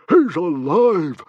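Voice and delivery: high, slow, booming shout of joy; astonished, delighted bellow. A narrator's reading. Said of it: "bellowed Stoic the Vast in astonished delight".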